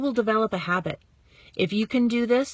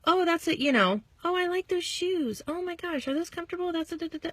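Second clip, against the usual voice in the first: gushing compliments; 8 semitones higher than usual. Shown as a demonstration. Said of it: "Comment on the hair".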